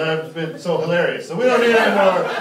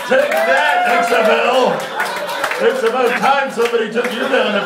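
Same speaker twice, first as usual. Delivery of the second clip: higher, louder triumphant yell; mock victory shout; 6 semitones higher, 4 dB louder than usual. Roasting Harry's XFL joke, a mock triumphant shout. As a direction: crow it like you just won a fight.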